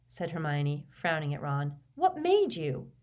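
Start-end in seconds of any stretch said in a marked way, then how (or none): 2.0-2.9 put-on much higher, reproachful girl's question; Hermione's voice. A narrator's reading. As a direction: voice the worried friend.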